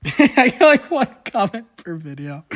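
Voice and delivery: high, loud, laughing through the words. Real, not acted. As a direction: crack up while saying it. Laughs at own comment joke.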